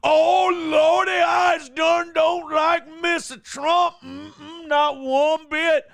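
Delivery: high, loud, slow hammed-up caricature; Joy Reid impression. Co-host mocks Joy Reid for the laughs, high hammed-up shout. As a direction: shout it high and drawn out, mocking. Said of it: "my impression of Joy Reid".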